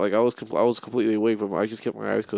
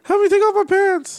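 loud falsetto plea for help; very high, drunken mock wail; Paco impression; 6 dB louder than usual. Friend mimicking a drunk woman asking for help, shrill mock impression. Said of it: "that's my Paco impression".

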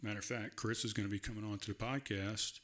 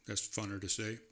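usual speaking voice

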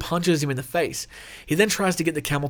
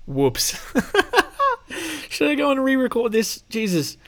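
laughing; 10 semitones higher than usual. Real, not acted. Laughing at his own gaffe.

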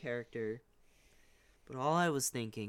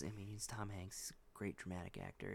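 softer, whispered aside; hushed aside; 6 semitones lower, 14 dB quieter than usual. Real, not acted. Reassuring listeners he respects Tom Hanks, whispered. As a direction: drop to a whisper as if confiding.

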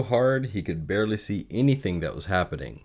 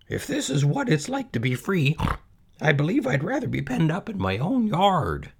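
put-on high-pitched young pig voice; high, unhappy character voice; worried little pig; 9 semitones higher than usual. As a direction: voice a small, worried piglet, high up. Frightened pig wishes himself penned.

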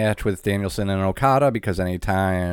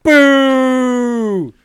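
mock boo; 14 semitones higher, 8 dB louder than usual. Mock booing.

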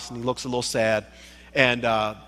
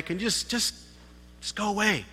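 hushed brush-off; 4 semitones higher, 10 dB quieter than usual. Pretend. Voicing a brush-off.

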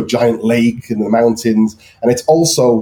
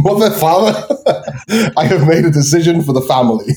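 high-pitched mock announcement, broken by laughter; mock solemn child; 6 semitones higher, faster than usual. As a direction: play at announcing a big decision, laughing midway. Jokes about choosing to emigrate as child.

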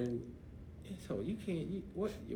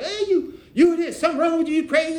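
put-on very high, loud teen shout; mock teen yell; 14 dB louder than usual. Niece yelling that he's crazy. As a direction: yell it at him like a teenager.

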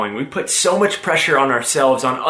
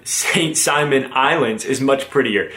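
ordinary talk, nothing marked

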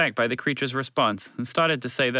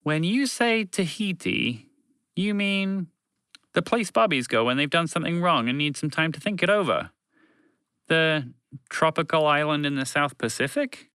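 lightly voiced moose, puzzled; 7 semitones higher than usual.

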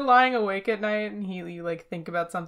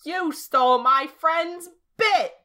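higher, much louder, slowing to a scold; mock scolding; 5 semitones higher, 7 dB louder, slower than usual. Fan scolding John Mulaney for stealing a friend's bit, mock scolding. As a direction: dress him down like a stern parent. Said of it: "so I can be like".

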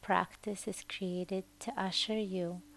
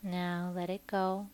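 guided meditation. Acted. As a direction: ease the listener gently toward sleep. Leads a Yoga Nidra meditation.

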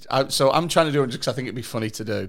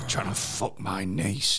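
low, slow mock growl; menacing growl; 8 semitones lower, 9 dB quieter, slower than usual. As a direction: growl it slowly like a menacing heavy. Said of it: "massive fucking growling like that".

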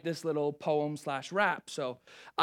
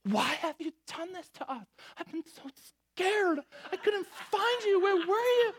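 frantic mother. Retold or imitated. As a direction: plead as a scared mom who lost her son. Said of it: "his mother said".

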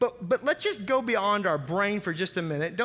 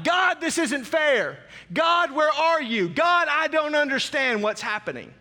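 desperate cry; 7 semitones higher, 5 dB louder than usual. Retold or imitated. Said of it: "You've cried out to God".

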